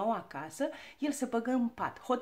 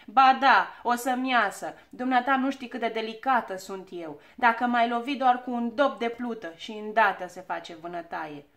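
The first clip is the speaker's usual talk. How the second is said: loud, fussy protest; aggrieved insistence; 8 dB louder than usual. A narrator's reading. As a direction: insist loudly, playing the delicate victim. Hurt girl argues about her bruise. (protest supărat, cu glas tare)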